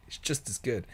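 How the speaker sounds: amused repeat through a laugh. Real, not acted. Laughing repeat of his own remark.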